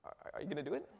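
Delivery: God quietly wondering, barely voiced; God's hushed thought. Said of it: "And the God's thinking".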